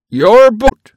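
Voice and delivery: loud, high incredulous question; loud, incredulous storekeeper; surprised question. A narrator's reading. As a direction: storekeeper taken aback. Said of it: "questioned the storekeeper".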